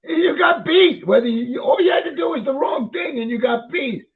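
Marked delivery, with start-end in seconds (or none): 0.0-2.3 outraged cry. Real, not acted. Outraged about slaves being beaten.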